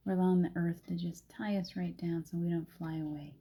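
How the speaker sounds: calm, unhurried grounding voice; guided meditation. Acted. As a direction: settle them into their feet.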